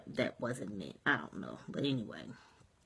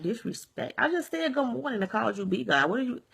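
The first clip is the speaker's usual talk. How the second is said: high-pitched, incredulous retort; retold disbelief, voice pitched up; puzzled protest; 6 semitones higher than usual. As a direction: act out being baffled by the accusation. Retells puzzled protest at accusation.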